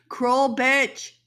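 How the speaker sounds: emphatic angry repeat. Real, not acted. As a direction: hit "Cruel bitch" harder the second time. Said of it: "cruel, cruel bitch".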